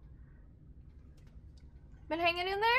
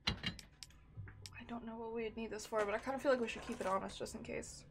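talking while crunching chips; chewing chips between words; 7 dB quieter than usual. Real, not acted. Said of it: "Sorry for the crunching A S M R".

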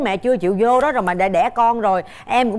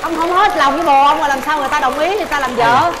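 exasperated scolding; 6 semitones higher, faster than usual. Real, not acted. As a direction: scold him in playful disbelief. Host mock-scolds the suitor, exasperated.

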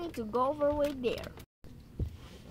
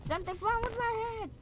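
Something's wrong with my head in a much higher, quick silly voice; mock squeaky voice; 12 semitones higher, faster than usual. Gamer joking about his character's head, high quick silly voice. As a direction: jump way up in pitch and rattle it off. Repeats line in new voices.

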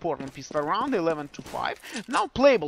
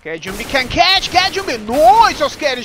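loud, higher urgent call; excited shout; 6 semitones higher, 10 dB louder than usual. Real, not acted. Caster calling action.

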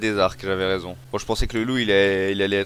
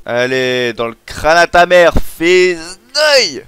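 loud, high taunting shout; all-out fighting roar, rising; battle yell; 8 semitones higher, 12 dB louder, much slower than usual. Real, not acted. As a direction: holler insults while swinging. Attacking an animal in game.

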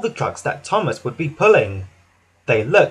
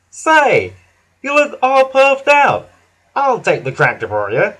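much higher, louder kindly offer; concerned Hank; 7 semitones higher, 5 dB louder than usual. A narrator's reading. Hank offering to take the tractor, high and kind. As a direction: gently offer to share the load. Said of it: "Hank whistled kindly".

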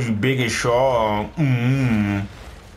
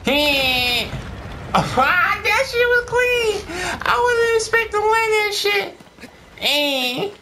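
high celebrating voice; slower than usual. Real, not acted. Celebrating an unexpected win.

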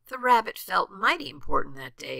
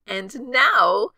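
high, louder, delighted exclamation; gleeful giggly Gertrude; 6 semitones higher, 9 dB louder than usual. A narrator's reading. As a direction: crow it with giddy delight. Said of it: "giggled Gertrude".

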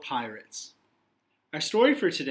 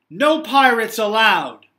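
louder, higher firm declaration; firm librarian; 5 semitones higher, 12 dB louder than usual. Acted. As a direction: lay down the law to the pirate. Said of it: "said Library Lou".

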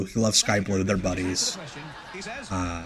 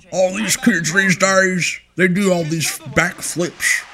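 higher, slow, put-on voice of a grumbling veteran; grumpy old-timer impression; 7 semitones higher than usual. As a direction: drag it out like a cranky old wrestling manager. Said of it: "That's my imitation of his mustache".

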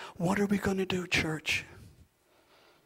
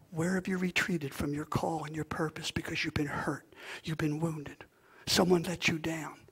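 soft unvoiced whisper; hushed, much softer; 7 dB quieter than usual. Real, not acted. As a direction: whisper it gently to the wounded. Pastor whispers to hurting listeners.

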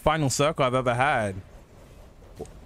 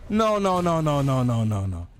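desperate, rising protest; raging gamer; 3 semitones higher than usual. Real, not acted. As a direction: plead no over and over.

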